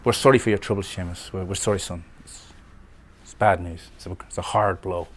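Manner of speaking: put-on old man's condolences in a mild Northern Irish accent. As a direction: say sorry gently in a Northern Irish lilt. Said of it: "That's my attempt at a Northern Irish accent".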